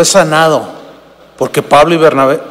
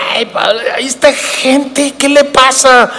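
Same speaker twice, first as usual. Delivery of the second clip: alarmed Bernabé; 6 semitones higher than usual. Retold or imitated. Preacher voices alarmed Bernabé.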